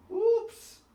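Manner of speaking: surprised oops. A narrator's reading. Mud splashes Daddy's shirt.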